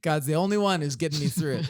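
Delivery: normal talking voice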